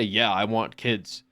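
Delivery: calm slow yeah; matter-of-fact reply; plain, slow answer. Shown as a demonstration. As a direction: say it simply and slowly. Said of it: "not going out there being like".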